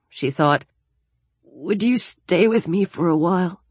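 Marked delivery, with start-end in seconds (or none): 1.4-3.6 thin, higher-pitched plea; fragile voice. Acted. Daniel asks Alex to stay, fragile. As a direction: ask timidly, worn out from crying. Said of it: "he said in that same fragile voice".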